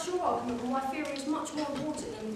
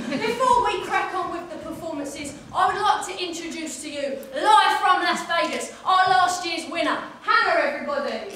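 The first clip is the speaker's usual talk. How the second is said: announcer; 2 semitones higher, 9 dB louder than usual. Acted. Host introduces last year's winner.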